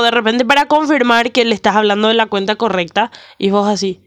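ordinary talk

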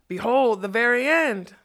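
loud showman's shriek; shrieking announcement. A narrator's reading. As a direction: announce the end to the crowd at full volume. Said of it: "Ruck shrieked".

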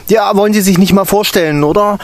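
high, loud, demanding; the dragon-like teacher pressing the newcomer; stern teacher. A narrator's reading. Teacher demanding the intern introduce himself, high and stern.

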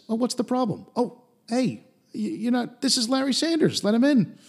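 mock eager guard, pitched high; a fawning guard recognizing the star. Security guard voiced in recap.

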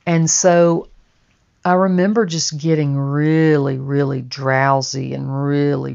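usual speaking voice

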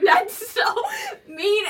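high, loud squeal through laughter; laughing protest. Retold or imitated. Girlfriend retelling her reply to Will, laughing loud protest. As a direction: protest in mock hurt, cracking up.

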